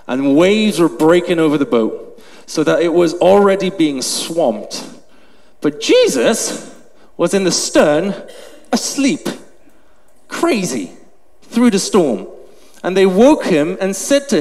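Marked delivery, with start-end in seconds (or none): none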